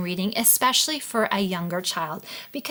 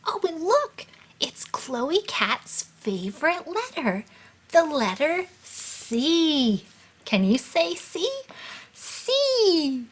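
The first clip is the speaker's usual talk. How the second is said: higher, slow, drawn-out delight; playful teaching voice; 5 semitones higher, slower than usual. Pretend. Showing a child Chloe Cat's favorite letter, playful slow teaching. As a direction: stretch the letter out for a toddler.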